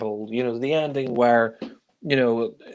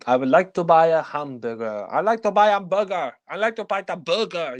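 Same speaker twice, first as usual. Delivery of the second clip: a lightly put-on French accent, climbing higher on the second try; mock French accent; 5 semitones higher than usual. Reviewer mimicking Clouseau's hamburger line, mock French accent. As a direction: hammer the fake French vowels on hamburger.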